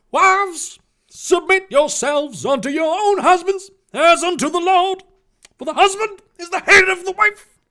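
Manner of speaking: high, booming fire-and-brimstone reading; preacher voice. Pretend. Reader whipping out Ephesians for a congregation, preaching. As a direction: thunder the verse from the pulpit. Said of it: "preacher voice".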